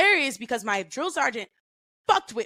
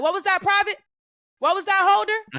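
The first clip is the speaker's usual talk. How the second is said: much higher, louder barking challenge; drill sergeant yelling; 10 semitones higher, 4 dB louder than usual. Retold or imitated. Drill sergeant yelling at a private who talked back. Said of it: "He like".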